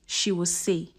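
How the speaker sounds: ordinary delivery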